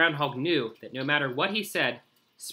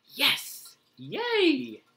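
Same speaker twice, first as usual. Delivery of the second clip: little cheering voice; 11 semitones higher, 3 dB louder than usual. Acted. Animal cheering in small voice.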